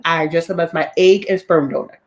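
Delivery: ordinary talk, nothing marked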